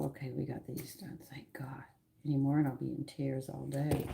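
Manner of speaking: whispered relief, half to yourself; relieved whisper. Real, not acted. Cook whispering relief after the onions are done. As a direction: breathe it out in a whisper, thankful.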